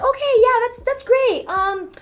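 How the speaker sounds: high mock casting director; high-pitched director. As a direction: go way up, ask sweetly. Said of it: "they're like".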